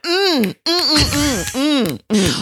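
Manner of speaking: high, sassy mm mm mm; mock disapproving mm-hmm snaps. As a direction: hum a sassy disapproving mm like a reality TV star.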